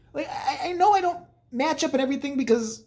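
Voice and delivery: ordinary delivery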